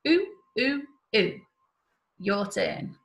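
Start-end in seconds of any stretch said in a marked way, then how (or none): 0.1-1.4 short clipped oo sounds, modelled. Shown as a demonstration. Teacher models short oo for children to copy. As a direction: say each oo short and crisp for the class. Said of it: "can you copy after me".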